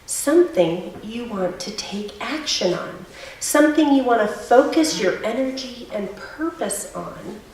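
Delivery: gentle, quiet meditation guide; lightly hushed guided breathing cue; soft spa whisper. Acted.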